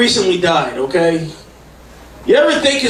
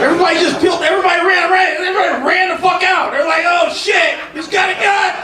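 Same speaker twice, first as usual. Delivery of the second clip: high, shouted panic; excited retelling; 9 semitones higher than usual. Real, not acted. Comic recalling the crowd running, high shout. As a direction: yell it like people fled.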